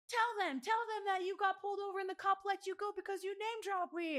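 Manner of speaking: high, loud urging on the phone; urgent insisting. Retold or imitated. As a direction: push the brother to confirm it, eager. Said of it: "call my brother and I'm like".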